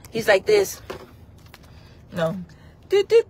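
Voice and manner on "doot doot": high beeping phone noise. Pretend. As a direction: beep like a phone line. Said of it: "when I do the phone calls".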